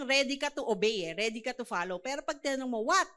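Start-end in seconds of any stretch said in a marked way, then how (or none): 2.8-3.1 short irritated what, rising; a put-out child snapping back what; child answering a parent with an annoyed What. Shown as a demonstration. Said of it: "Ganun yung tono eh".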